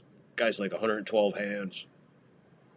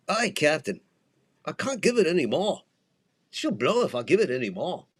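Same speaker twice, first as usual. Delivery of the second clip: higher-pitched Scottish engineer straining "Aye, Captain"; Scottish accent; 7 semitones higher, 3 dB louder than usual. Pretend. Host imitating Scotty from Star Trek, Scottish accent. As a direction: do Scotty's Scottish brogue, pleading. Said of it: "let me see if I can get my Scottish down".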